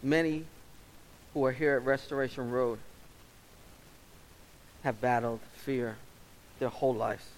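earnest pastor's prayer, measured and steady; closing prayer. Acted. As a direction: pray over the congregation.

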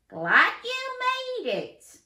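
high, loud, slow yell. Acted. Coach yells welcome to Dan across the park. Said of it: "yelled Coach Carl Chameleon".

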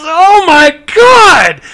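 drawn-out, loud, high gasp of shock; man at the urinal, stunned, loud and high; shocked exclamation. Retold or imitated. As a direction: stretch it out in loud disbelief. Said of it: "he goes".

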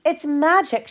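high, soft storybook exclamation; excited cry. A narrator's reading. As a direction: read it as a contained cry of wonder. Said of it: "she cried".

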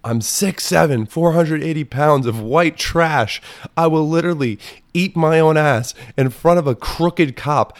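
hammed-up trashy rant; mock unhinged boast. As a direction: bellow it like a proud lunatic. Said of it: "I said".

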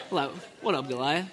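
low, laid-back, soft swagger; cool casual greeting. Retold or imitated. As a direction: greet the giant like it's nothing. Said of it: "Probably didn't say it like that".

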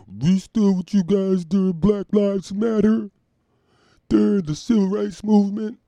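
high, slow mocking whine; mock complaining comedians. Mocking the comedians, high whiny imitation. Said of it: "they were mad".

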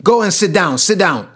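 irritated. Retold or imitated. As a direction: tell him firmly to sit down, losing patience. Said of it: "I get angry, I get irritated".

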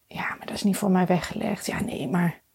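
hushed inner doubt, whispered; whispering. Retold or imitated. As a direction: breathe it out like a secret fear. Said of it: "dat ze dan ook meteen met".

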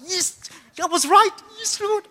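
high, slow thrilled cry; high happy shout to the Lord; excited. Retold or imitated. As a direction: celebrate with a high, slow yes. Stoked, excited on the bus.

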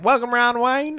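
high-pitched mock West Texas greeting; West Texas accent. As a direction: imitate her loud West Texas twang up high. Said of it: "she's got that West Texas".

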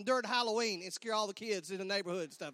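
ordinary talk, nothing marked

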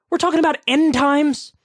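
stunned she said end times; high shocked repeat; incredulous echo. Real, not acted.

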